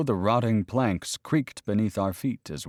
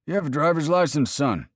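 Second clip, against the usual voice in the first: officious warden; 8 semitones higher, 4 dB louder than usual. Acted. Second warden appears suddenly.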